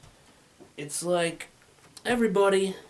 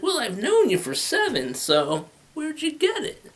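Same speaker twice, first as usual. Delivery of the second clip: put-on suspicious girlfriend; 11 semitones higher than usual. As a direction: squeak it out like a doubting girlfriend. Girlfriend questioning where shirt came from.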